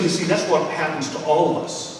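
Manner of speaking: ordinary talk, nothing marked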